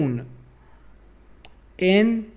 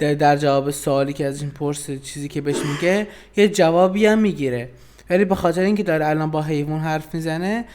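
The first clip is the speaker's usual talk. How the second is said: usual speaking voice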